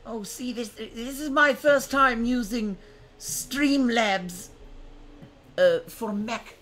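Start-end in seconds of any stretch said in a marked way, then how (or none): none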